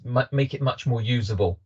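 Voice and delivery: normal talking voice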